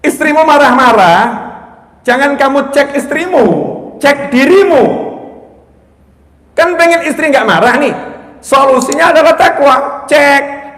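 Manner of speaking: loud, high-pitched preaching at full force; khotbah berapi-api. Real, not acted. (khotbah lantang dengan nada tinggi)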